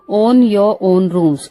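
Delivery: each word laid out slowly for learners; teacher slowly unpacking a brand name; slow spelled-out expansion. Shown as a demonstration. Teacher spelling out OYO for students, slowly. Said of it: "ओयो".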